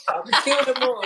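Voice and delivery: high, loud mock threat through laughter; laughing threat. Real, not acted. Wife joking about rival wives, laughing threat.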